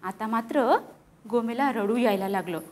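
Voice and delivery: ordinary talk, nothing marked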